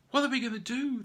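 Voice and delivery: high, loud panicked bears; frightened cry. Acted. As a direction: cry out together in alarm. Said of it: "they all cried as one".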